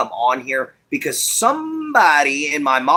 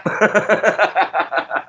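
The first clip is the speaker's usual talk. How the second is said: staccato laugh, higher in pitch; amused, trailing chuckle; chuckling laugh; 4 semitones higher than usual. Real, not acted. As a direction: laugh in a quick run of ha-has. Laughs at listener's comment.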